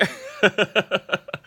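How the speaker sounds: bright burst of high laughter. Real, not acted. Laughing after retold quote.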